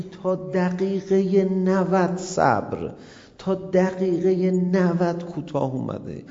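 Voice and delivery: slow, drawn out; slow emphasis. Acted. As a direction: stretch each word to the last minute. Stretches "ninetieth minute" patience. (آهسته و کشیده)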